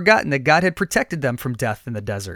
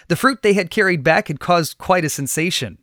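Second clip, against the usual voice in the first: usual speaking voice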